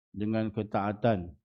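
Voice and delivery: ordinary talk, nothing marked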